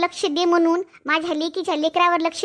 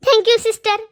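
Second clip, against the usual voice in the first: grateful thanks; 6 semitones higher, 6 dB louder, slower than usual. Acted. Grateful mother thanks nurse loudly.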